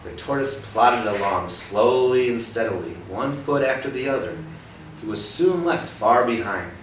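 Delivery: lively picture-book narration; storybook reading. Acted. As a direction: read the race like an exciting story.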